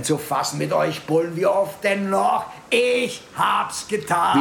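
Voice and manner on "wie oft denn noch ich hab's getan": higher, slow, emphatic insistence; drawn-out, fed-up protest; exasperated confession. Acted. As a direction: spell it out in exasperation. Exasperated suspect repeats his confession.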